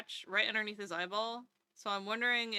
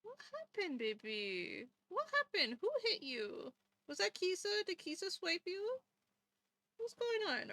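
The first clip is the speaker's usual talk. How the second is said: higher, soft fussing voice; concerned pet talk; 6 semitones higher, 4 dB quieter than usual. Real, not acted. Owner worrying over cat's scratch, high pet talk. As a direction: fuss over an injured pet.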